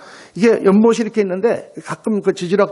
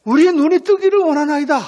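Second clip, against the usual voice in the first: high, raised-voice entreaty; pleading; 7 semitones higher, 4 dB louder than usual. Retold or imitated. Blind men answer Jesus, an imitated high plea. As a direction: call out the wish, pitched well up.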